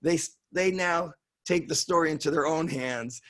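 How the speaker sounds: normal talking voice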